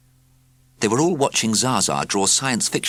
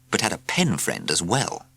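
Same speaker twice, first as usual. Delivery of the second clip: ordinary delivery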